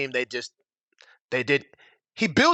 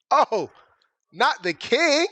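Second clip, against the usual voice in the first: mock dismay; 9 semitones higher, much slower than usual. Dismay hearing of the king.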